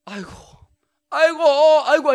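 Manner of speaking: a sick wife's exaggerated wailing for attention; loud moaning. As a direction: moan so the husband must hear. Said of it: "들으라고".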